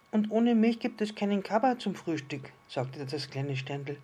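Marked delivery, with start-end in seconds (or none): 0.1-2.5 high, louder little-star voice; little star, realising. Acted. Little star realises breakfast cocoa is at stake, piping up high. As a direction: pipe up as a small storybook star.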